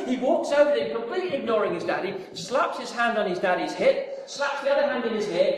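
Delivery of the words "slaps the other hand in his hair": usual speaking voice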